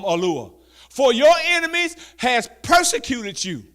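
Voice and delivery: high, loud, slow preacher's call; preaching scripture. Acted. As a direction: proclaim it to the congregation. Scripture read at full force.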